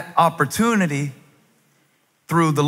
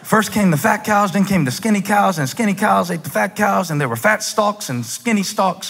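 high, sing-along Southern retelling; Pharaoh in Southern accent; 7 semitones higher than usual. Acted. Preacher playing Pharaoh recounting his dream, Southern accent. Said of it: "Pharaoh's talking like this".

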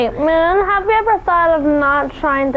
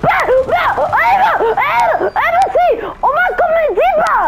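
excited anime fan. Real, not acted. Panicked screaming at game moment.

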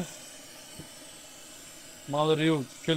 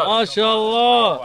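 impressed; 6 semitones higher, 7 dB louder, slower than usual. Real, not acted. Impressed loud slow praise.

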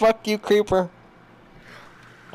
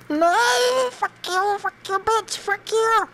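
much higher pitch, frantic insults; panicked cursing; slower than usual. Real, not acted. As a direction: throw the curses up high in panic. Fighting a mob in panic.

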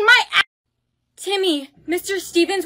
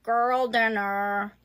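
deadpan; 7 semitones lower, 6 dB quieter, slower than usual. Acted. Slow low deadpan meme sayings.

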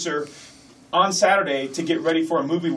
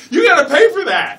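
laughing loud, much higher; 12 semitones higher, 12 dB louder than usual. Real, not acted. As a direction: blurt it through a laugh, much higher and louder. Laughing joke about sneaking in.

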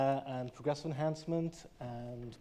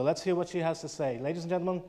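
higher, louder announcer build to the name; stage introduction; 3 semitones higher, 5 dB louder than usual. Real, not acted. Host welcomes the final speaker to the stage, announcer style.

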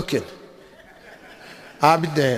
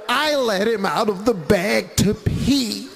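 ordinary talk, nothing marked

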